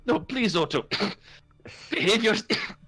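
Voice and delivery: dwarf stifling laughter. Acted. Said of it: "As she's just".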